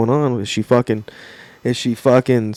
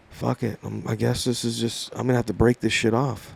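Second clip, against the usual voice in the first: quiet, low-energy thinking aloud; resigned inner thought; 3 semitones lower, 11 dB quieter than usual. Retold or imitated. Boyfriend privately deciding to end it, quiet and resigned. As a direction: say it softly, as if to yourself.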